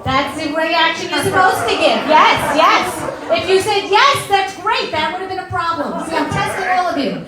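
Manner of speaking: loud playful telling-off to the crowd; mock scolding. Real, not acted. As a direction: scold the audience, half joking. Scolding audience after groans.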